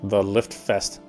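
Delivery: ordinary talk, nothing marked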